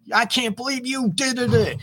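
high, mock furious outburst; angry yell. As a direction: act out losing your temper at your partner. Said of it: "And we yell, we scream".

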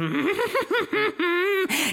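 high villainous cackle; evil cackle. Acted. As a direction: cackle like a gleeful monster. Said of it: "it cackles".

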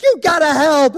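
imitated mom, high and loud; hammed-up hysterical demand for help; frantic yell. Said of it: "just starts going crazy".

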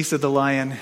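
ordinary delivery

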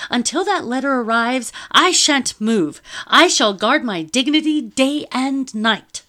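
high, loud, slowing defiant lady; imperious lady. A narrator's reading. Lady defying the soldiers: high loud imperious decree. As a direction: declare it like a queen.

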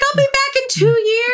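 high, insistent mock assurance; hammed-up high-pitched reassurance; mock husband's promise. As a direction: promise it like an over-eager husband. Husband promising his wife, acted.

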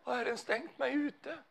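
anxious question. Retold or imitated. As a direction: voice the fearful believer's cry. Voicing a doubting believer's question. (ängslig fråga, mycket ljusare röst)

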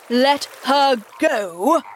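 slow, loud, incredulous echo; aghast cry. A narrator's reading. Hook hears the prisoner was released, loud disbelieving cry. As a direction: draw out LET HER GO, rising in disbelief. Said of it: "cried Hook".

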